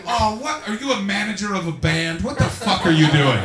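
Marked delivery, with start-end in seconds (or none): none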